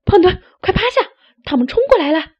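high, loud rooster voice yelling; Loudmouth yelling. Acted. Loudmouth warning Chubby of the crash, loud high yell. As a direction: bird character shrieking a warning. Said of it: "大嗓门儿叫道".

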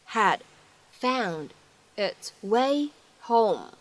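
each word spaced out and slowed. Shown as a demonstration. Teacher reading slowly word by word for students. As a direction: break the line into single words for the learners. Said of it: "需要注意哪些发音技巧".

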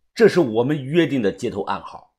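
ordinary delivery

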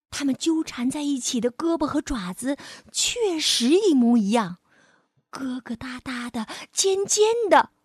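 put-on gentle storyteller reading to a child; lively read-aloud for kids; expressive bedtime-story voice; story narration. A narrator's reading.

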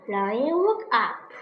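usual speaking voice

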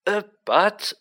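rattled official, stumbling; stuttering. A narrator's reading. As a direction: falter over the objection. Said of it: "stuttered Fudge".

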